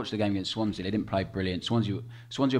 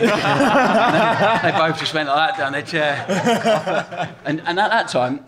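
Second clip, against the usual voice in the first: higher, louder, words through laughter; giggling as he tells it; cracking up at the memory; laughing; 7 semitones higher, 9 dB louder, much slower than usual. Real, not acted. Laughing retelling the players' reaction.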